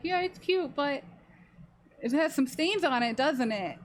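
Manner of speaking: lightly voiced teasing dad, high start; a hint of a goofy dad ribbing her; dad's funny voice. Dad teasing her about stains, lightly mimicked. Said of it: "really, like, funny voice".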